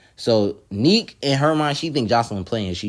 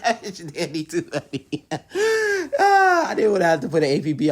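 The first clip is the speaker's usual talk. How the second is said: talking through high-pitched laughter; helpless laugh breaking into the words; cracking up; 9 semitones higher, 4 dB louder than usual. Real, not acted. Reacting to the clip she just played, laughing hard.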